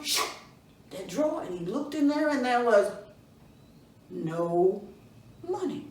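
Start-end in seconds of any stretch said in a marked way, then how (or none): none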